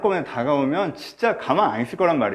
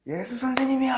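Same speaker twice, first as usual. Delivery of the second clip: higher, aching plea; pleading cry; 8 semitones higher than usual. Retold or imitated. Lepers pleading to Jesus, aching tearful plea. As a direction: call out as if near tears. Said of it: "되게 많이 울었어요".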